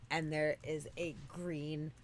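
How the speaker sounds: ordinary delivery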